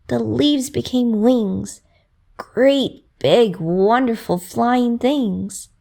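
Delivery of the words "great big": ordinary delivery, nothing marked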